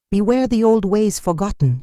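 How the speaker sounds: a lightly voiced elder cautioning the crowd; mild foreboding warning. A narrator's reading. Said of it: "she warned".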